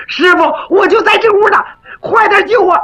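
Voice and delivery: 高嗓门急着喊师傅; 拼命喊人来救; 高声呼救. Acted. 韩宝高声回应师傅呼唤. (带哭腔高声喊救命)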